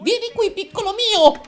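very high, insistent coaxing; frantic, high-pitched maternal call; creepy mother calling. Acted. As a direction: squeal for your little one to come. Voices the mother, escalating repeat.

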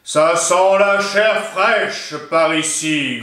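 a hungry monster sniffing at the door; growling ogre. A narrator's reading. Ogre at the witch's door, slow growling menace. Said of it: "gronda l'ogre".

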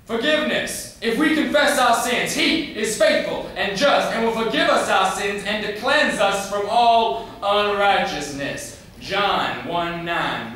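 loud, high preacher's proclamation; fervent scripture recital. Acted. Boy performs Daddy's Bible verse on forgiveness, fervent preaching. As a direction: declaim the verse at full force, slowing at the end. Shouts scripture in father's monologue.

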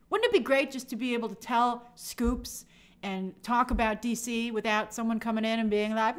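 usual speaking voice

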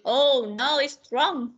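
ordinary talk, nothing marked

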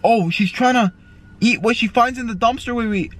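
usual speaking voice

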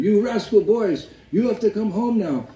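put-on high-pitched telling-off of naughty boys; mock scolding mother. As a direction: call them home in a high scolding voice. Angry mother scolding her boys.

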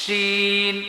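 শিন drawn out with the tongue tip making the sh; tongue-tip sheen. Shown as a demonstration. Teacher shows an alternative articulation, tongue-tip শিন. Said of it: "এটা লাগাইছি".

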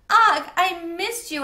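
high, loud, slow shout; joyful shout. A narrator's reading. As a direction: shout out with joy. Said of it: "I shouted".

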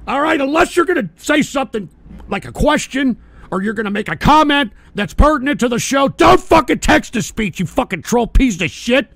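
loud, high furious rant; raging tirade; angry rant. Real, not acted. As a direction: chew out the troll. Streamer ranting at TTS troll.

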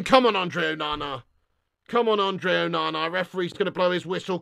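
low, soft plea to the keeper; will the keeper on under your breath; tense, low urging. Real, not acted. Urging keeper before the penalty.

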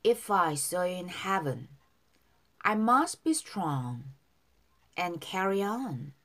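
very slow, drawn-out English phrases; slow model reading. Shown as a demonstration. Teacher models song lyrics for learners, slow and drawn out. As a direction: stretch each word for learners to follow. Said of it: "바로 밑에 이 절 발음 따라서".

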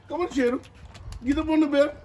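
calling the dog. Real, not acted. Coaxing dog onto its bed.

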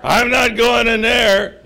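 loud, high, half-laughing protest; comic recoil. Acted. Jesus backing out of the smelly cave, loudly appalled. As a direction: play it as a big mock recoil.

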